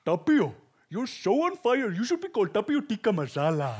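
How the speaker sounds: put-on a church friend's Indian accent, full of cheer. Indian friend teasing the on-fire Tapio, high laughing Indian accent. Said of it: "through an Indian accent".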